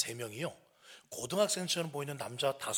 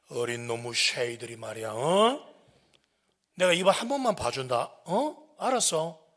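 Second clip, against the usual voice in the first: stern, loud telling-off; gruff warning; 11 dB louder, slower than usual. Retold or imitated. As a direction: let them off like a tough authority. Letting the teens off with warning.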